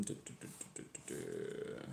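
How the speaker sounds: very soft, absent-minded doo-doo-doo; idle filler sounds. Real, not acted. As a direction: murmur filler syllables while searching. Killing time searching for the passage.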